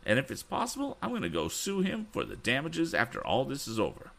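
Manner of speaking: put-on high, worked-up old gentleman; indignant Mr. Damon. A narrator's reading. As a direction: fume about the scoundrel, pitched high. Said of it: "cried Mister Damon".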